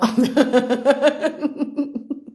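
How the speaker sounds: long mocking laugh; scornful laugh. Real, not acted. Laughing at Ma Poussin for running away, scornful laugh. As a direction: laugh at her rival.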